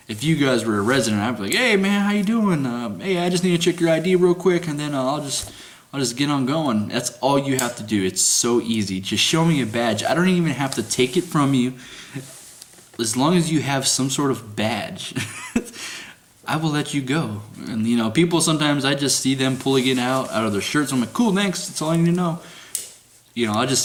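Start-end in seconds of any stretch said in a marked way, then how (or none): 1.5-6.9 high, bright friendly greeting; friendly guard. Retold or imitated. Guard greeting a resident for an ID check, cheery and high. 21.1-22.4 breezy thanks. Retold or imitated. Quick thanks to badge-showers.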